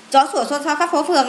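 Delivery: rattled off in one go; quick recitation. Shown as a demonstration. Teacher recalling the memory phrase for pupils, quick recitation. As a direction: run through the phrase briskly.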